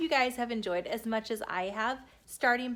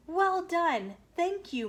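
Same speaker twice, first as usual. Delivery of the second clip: delighted praise; 7 semitones higher, 6 dB louder, slower than usual. Acted. King applauds the performance.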